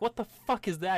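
high, loud appalled question; shocked disbelief. Acted. Party seeing the fused creature, high loud disbelief.

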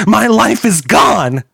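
high, loud anguished scream. A narrator's reading. Hacker screaming over his lost data, high anguished cry. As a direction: scream it out, slowly, in despair. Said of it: "Kilroy screamed".

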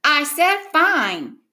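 high, slow exasperated retort; yelling back. A narrator's reading. Brother to nagging sister over his game, yelling back. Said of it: "he yelled back".

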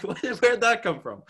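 loud, high-pitched mock bafflement; baffled disbelief. Reacting to the online pile-on, loudly baffled. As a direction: blurt it out high and incredulous.

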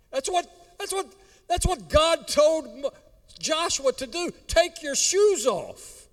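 high, far-reaching preaching; preaching at full force. Acted. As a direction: proclaim it high.

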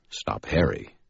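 ordinary delivery

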